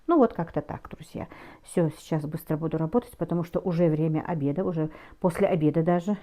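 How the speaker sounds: usual speaking voice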